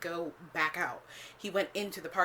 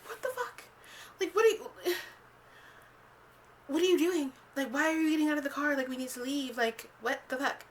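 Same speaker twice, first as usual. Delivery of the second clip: shocked disbelief; 7 semitones higher than usual. Retold or imitated. Shocked quote to date in car.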